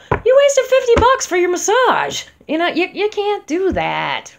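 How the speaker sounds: high, loud exasperated rant; hammed-up frantic outburst, high and loud; mock freak-out. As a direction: act out a client wasting the massage. Acting out freaking out.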